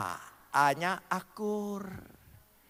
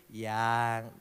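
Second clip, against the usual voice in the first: "Yang" said soft and low, full of loving tone; tender loving yang; 8 semitones lower, 4 dB quieter than usual. Shown as a demonstration. Husband calling his wife sweetly, tender and soft. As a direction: coo "Yang" gently to your wife. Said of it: "intonasinya itu penuh nada kasih".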